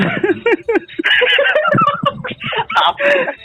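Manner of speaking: big burst of laughter. Real, not acted. Laughing hard while telling a friend the story.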